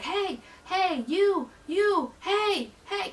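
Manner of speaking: high, drawn call for attention; imitated yelling. Guard flagging down a passing coworker, mock yelling. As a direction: imitate someone yelling to flag a person down. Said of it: "like, yelling in the stadium".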